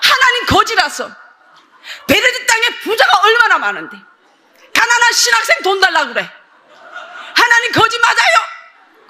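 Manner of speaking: high-pitched, indignant complaint to heaven; retold protest to God. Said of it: "하늘 쳐다보고 내가 하나님 앞에 이렇게 얘기했어요". (하나님께 따지듯 높은 소리로 항의)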